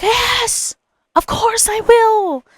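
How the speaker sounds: very high, drawn-out thrilled yes; delighted acceptance. Retold or imitated. Accepting role in friend's wedding, delighted. Retelling yes to best man request.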